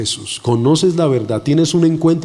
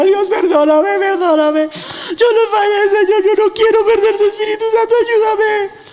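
re-enacted sobbing prayer, very high-pitched; tearful wailing plea. Preacher relives begging God for forgiveness, crying wail. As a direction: cry out desperately, voice way up, words stretched. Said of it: "Yo lloraba y le decía".

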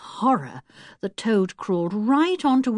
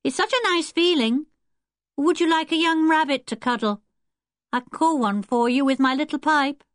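put-on high, even boyish voice; boy calling out; 5 semitones higher than usual. A narrator's reading. Wilfred offering Anne a young rabbit, calling in a high voice. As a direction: offer it brightly from a distance, pitched up.

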